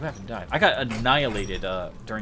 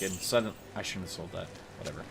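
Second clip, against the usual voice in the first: ordinary talk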